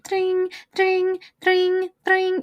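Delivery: high, steady trilled dings; imitated machine ringing. Storyteller imitating a winning slot machine, high ringing. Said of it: "it was just like".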